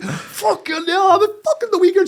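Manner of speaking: high, loud exasperated yell; outraged outburst. Retold or imitated. Coach protesting for injured girl, high outraged yell. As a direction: shout it high and incredulous.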